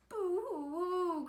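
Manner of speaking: slow, high hammed-up boo-hoo; mock boo-hoo. A narrator's reading. Brother wails that he is left out, mock sobbing. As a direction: fake sob it slowly and high. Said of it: "cried Piggy's brother".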